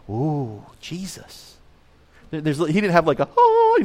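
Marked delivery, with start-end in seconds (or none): 0.1-1.6 hushed, breathy mock wonder at the name; mock awe. Pastor mocking kids gushing over young Jesus, hushed mock awe. 3.3-3.8 high squeak. Real, not acted. Pointing to halos.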